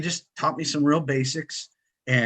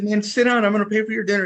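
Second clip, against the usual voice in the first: eager welcome; 9 semitones higher, 4 dB louder, faster than usual. Retold or imitated. Calling friend over to table.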